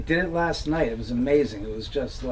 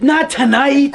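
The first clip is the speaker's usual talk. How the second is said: high, punched comic exclamation; laughing mock alarm; jokey reassurance; 10 semitones higher, 4 dB louder, slower than usual. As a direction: pitch it way up for the punchline. Jokingly reassuring frightened young bloggers.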